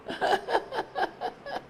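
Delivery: squeaky high laugh; high giggle. Real, not acted. Preacher amused by his own quote, high giggle. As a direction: let a high laugh escape.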